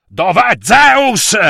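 loud, slow, high roar; shouted. Acted. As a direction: bellow the question slowly. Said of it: "Il dio fiume Asopo gridò".

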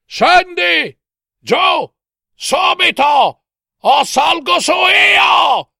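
very high, drawn-out yell; furious shout. A narrator's reading. Husband threatens to come up, shouting. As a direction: shout slow, stretched orders up the stairs. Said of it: "gridava Barba Blu".

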